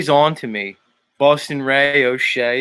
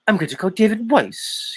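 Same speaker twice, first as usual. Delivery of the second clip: raised pitch, mock phone-call announcement; Nathan Oakley; 8 semitones higher than usual. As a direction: put on a higher voice for the husband. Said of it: "Nathan's like".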